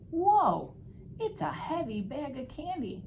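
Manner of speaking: acted astonishment at a heavy load; amazed at weight. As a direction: exclaim it high, as if heaving. Policeman straining at heavy bag.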